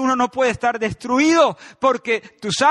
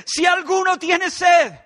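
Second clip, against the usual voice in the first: high, slow, loud cry of an invitation; a preacher crying out Jesus' invitation, loud and slow; shouted call; 9 semitones higher, 6 dB louder, slower than usual. Acted. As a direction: shout it out slowly to the whole crowd. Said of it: "con mi grito".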